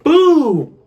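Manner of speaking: high, loud mock yelp as he flips; startled yelp. Flipping over in the wave pool, startled yelp. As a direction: cry out as the tube flips.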